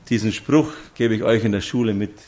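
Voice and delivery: normal talking voice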